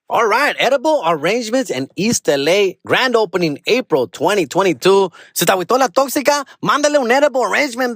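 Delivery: high, upbeat sponsor read. Acted. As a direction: sell it like a radio spot. Reads sponsor ad.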